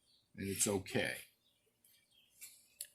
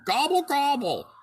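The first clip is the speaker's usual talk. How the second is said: high, loud gobbling turkey call; turkey gobble; 12 semitones higher, 4 dB louder than usual. A narrator's reading. Turkey cock chasing the duckling, loud high gobble.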